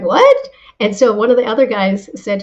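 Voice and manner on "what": high, incredulous yelp of What; a startled squeak of disbelief at the repeat; stunned disbelief. Retold or imitated. Hearing her own idea repeated back, a high shocked What. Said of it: "I was like".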